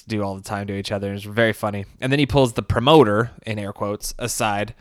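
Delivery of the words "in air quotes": usual speaking voice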